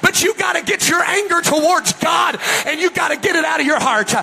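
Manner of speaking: high, urgent revival exhortation; full-force preaching. Real, not acted.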